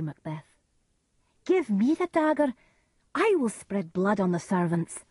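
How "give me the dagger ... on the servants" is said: high, forceful demand settling into resolve; commanding. Acted. Lady Macbeth demands the dagger from her weak husband, commanding. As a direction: take over firmly.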